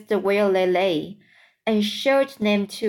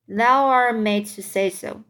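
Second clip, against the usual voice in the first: usual speaking voice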